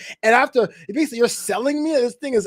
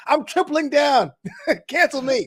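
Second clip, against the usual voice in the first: ordinary talk, nothing marked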